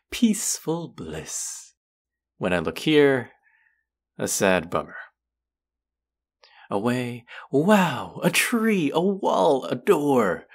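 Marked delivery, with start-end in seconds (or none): 0.1-1.7 mock bliss. Mock contrast, looking away. 6.7-10.5 much higher and louder, hammed-up wonder; sarcastic over-the-top excitement at plain things; mock amazement.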